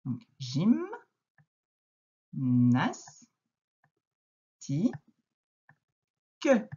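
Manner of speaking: teacher spelling out syllables. Shown as a demonstration. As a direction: break gymnastique into gym, nas, ti, que with long pauses. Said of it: "la lettre G va faire J aussi".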